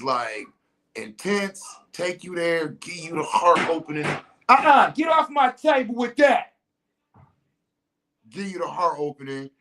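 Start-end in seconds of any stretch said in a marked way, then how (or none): 4.5-6.5 dismissive refusal. Retold or imitated. Rejecting the offer.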